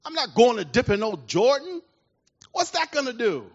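a proud general balking at a lowly order; indignant general. Retold or imitated. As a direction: Naaman refusing to dip in the Jordan, indignant. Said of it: "he's indignant".

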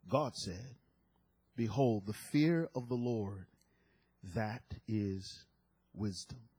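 low, subdued preacher reading; solemn scripture reading. Real, not acted. Preacher reading Peter and Job to congregation, solemn. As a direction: bring it down low and read with gravity.

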